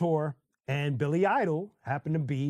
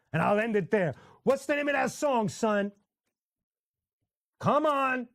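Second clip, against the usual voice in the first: normal talking voice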